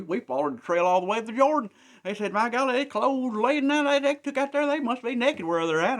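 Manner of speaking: high, loud amazed scouts; excited report. Acted. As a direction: blurt it out in wonder, voice up high. Scouts report the abandoned camp.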